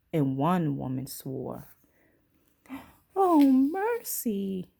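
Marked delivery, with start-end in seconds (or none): 3.2-4.7 high, slow aghast oh mercy; shocked gasp. Acted. Shocked woman watching the stack crash, aghast. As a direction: clutch your pearls.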